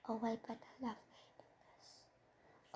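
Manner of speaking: soft, slow whisper to himself; whispered aside. Real, not acted. Thinking aloud about scripting versus randomness, slow whisper. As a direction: breathe it out as a secret thought. Private aside while deciding format.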